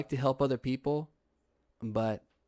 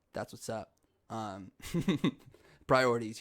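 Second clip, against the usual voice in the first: usual speaking voice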